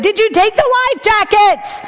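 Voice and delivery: put-on calling after kids heading off in a boat.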